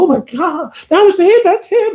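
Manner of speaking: high, loud cry of recognition; awed realization. Retold or imitated. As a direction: gasp it out in amazement. Older man's realization.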